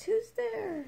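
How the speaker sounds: high little mouse cry; a tiny mouse calling out; squeaky mouse voice. A narrator's reading. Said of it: "Mouse cries".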